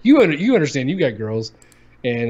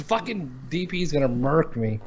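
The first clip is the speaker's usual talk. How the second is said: ordinary delivery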